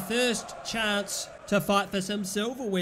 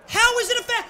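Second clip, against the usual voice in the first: much higher and louder disbelief; outraged protest; 11 semitones higher, 6 dB louder, faster than usual. Real, not acted. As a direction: shout at the ref in outrage. Protesting the referee.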